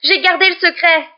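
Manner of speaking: loud, high, outraged protest; high-pitched cry. A narrator's reading. Girl reproaching professor over the secret, crying out high. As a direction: shout it as one betrayed. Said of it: "s'écria Hermione d'une voix aiguë".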